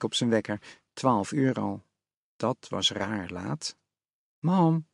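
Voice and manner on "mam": a mild call for his mother; a boy calling for his mom, lightly voiced. A narrator's reading. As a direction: call up the stairs, only lightly raised. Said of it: "riep hij".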